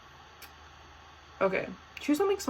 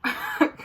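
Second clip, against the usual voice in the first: high giggle; 3 dB louder than usual. Real, not acted. Laugh after thumbnail.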